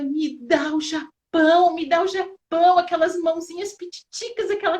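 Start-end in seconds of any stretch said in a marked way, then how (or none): 0.0-1.7 louder, slow, insistent plea; fervent retold prayer. Mother retells her daughter's fervent prayer, loud and insistent. 1.7-2.8 louder, higher, pressing plea; fervent retold prayer, higher. Mother retells her daughter's prayer, louder and higher on the repeat.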